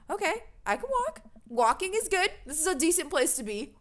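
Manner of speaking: high, bright relief; relieved excitement. Acted. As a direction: gush with relief. Relieved reading own character.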